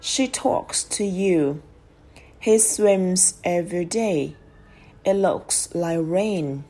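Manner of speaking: slow, word-by-word example sentences; careful model pronunciation. Shown as a demonstration. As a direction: read slowly for learners, sounding each verb's final s. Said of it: "thì phải đọc chuẩn". (đọc chuẩn, chậm rãi từng từ)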